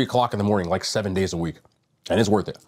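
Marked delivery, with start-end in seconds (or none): 2.1-2.6 talking while chewing fries; words round a bite of food; mouth full. Real, not acted. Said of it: "I'm still eating the fries".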